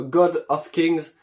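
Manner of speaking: slow, hammed-up English accent on the title; mock English accent. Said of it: "avec mon magnifique accent anglais". (accent anglais exagéré, lent et appuyé)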